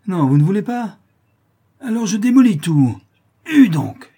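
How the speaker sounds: high, very loud shouting; rowdy outburst at full volume; furious threat. A narrator's reading. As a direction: bellow it as you attack the door. Drunk smashes the door in.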